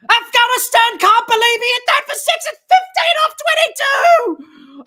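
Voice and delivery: high, full-voiced screaming excitement; ecstatic shouting commentary. Real, not acted. Commentator to viewers as Maxwell clears the roof, ecstatic shout. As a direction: roar the six home, voice soaring. Live commentary on a match-winning six.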